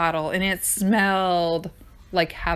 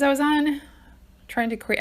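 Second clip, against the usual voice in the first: breathless from running around; panting between words; out of breath; 8 semitones higher than usual. Real, not acted. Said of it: "I'm out of breath".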